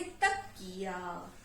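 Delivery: तकिया drawn out in two slow parts, "tak... kiyaa"; sounded out. Shown as a demonstration. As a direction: say तकिया very slowly, split for spelling. Teacher sounds out word for spelling.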